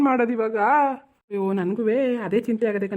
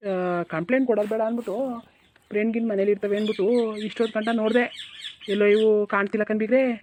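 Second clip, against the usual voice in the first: ordinary talk, nothing marked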